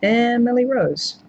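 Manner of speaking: drawn-out words, timed to the pen; slow writing-down pace. Real, not acted. Streamer noting a viewer's costume idea, slow writing-along pace. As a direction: say it slowly while jotting it down. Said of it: "I'll write it down".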